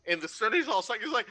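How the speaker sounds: usual speaking voice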